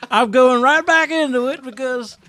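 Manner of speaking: high, loud cartoonish hype voice; goofy high voice. Host jumps back into his silly voice to pitch social media. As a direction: ham it up, squeaky and proud. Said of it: "you can't just jump back into it".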